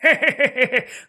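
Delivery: long raspy high laugh; long hoarse laugh. Acted. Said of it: "tiếng cười dài khàn khàn".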